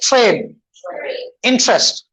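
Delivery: "ट्रेन" as chrain, "इंटरेस्ट" as in-chrest. Shown as a demonstration. Teacher models for repetition, ch-like TR in "ट्रेन", "इंटरेस्ट". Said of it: "चा का sound आता है".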